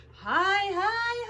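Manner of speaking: reaching up high; rising high. Acted. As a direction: stretch the voice up high. Crane rising.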